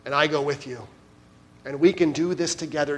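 soft, quiet, reassuring; a hint of Jesus's gentle voice. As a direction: lift them up gently. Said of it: "hear the voice of Jesus saying to you".

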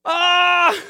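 long, loud high shriek; hammed-up wordless scream of panic; mock terrified scream. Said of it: "coming out of their mouth other than".

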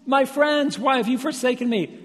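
loud, very high outcry; imagined cry. Retold or imitated. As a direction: cry out to friends who deserted. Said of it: "He could have cried out".

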